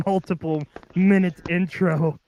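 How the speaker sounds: ordinary delivery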